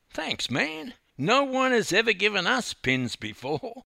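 very high, thrilled youth voice; beaming delight. A narrator's reading. Youth thanking Dawn for a kangaroo pin, beaming.

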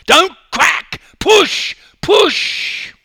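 screaming. Acted. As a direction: drive her through the pain. Coach yelling during the race.

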